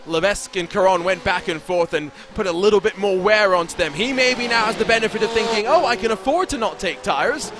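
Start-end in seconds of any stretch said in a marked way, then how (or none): none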